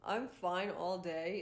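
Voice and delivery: ordinary talk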